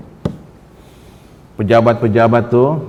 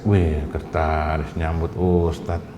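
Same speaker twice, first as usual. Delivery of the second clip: mock awe; 10 semitones lower, 8 dB quieter, slower than usual. Mimicking awe at welcome.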